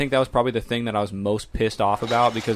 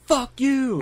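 high mock yell; 14 semitones higher than usual. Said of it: "they're like".

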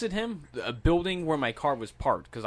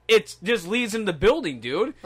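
higher, louder mock insistence; retold exasperated repeat; 8 semitones higher, 5 dB louder, slower than usual. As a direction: spell it out again for the clueless guy, imitating him. Man repeats his exasperated explanation.

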